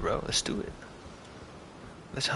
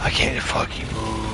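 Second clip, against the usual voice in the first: whispering. Real, not acted. Stuck, dying in fight.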